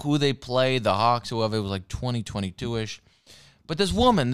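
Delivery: ordinary delivery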